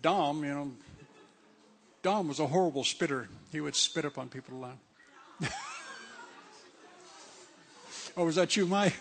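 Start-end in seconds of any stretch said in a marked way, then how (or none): 5.4-6.4 sudden high burst of laughter; high cackle of laughter. Real, not acted. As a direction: laugh out at the memory. Laughing at spitter story.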